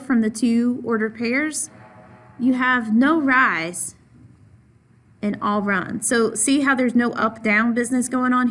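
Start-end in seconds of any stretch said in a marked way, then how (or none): 2.4-6.0 slow, deliberate, each word weighted, all stressed; slow emphasis. Shown as a demonstration. Teacher slowing down to stress all run. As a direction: spell out no rise in all run slowly for the class. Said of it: "I want to emphasize here".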